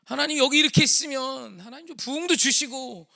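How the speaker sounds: high, loud wailing plea to God; anguished outcry. Retold or imitated. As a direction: build from protest to full-voiced screaming prayer. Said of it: "절규를 했어요".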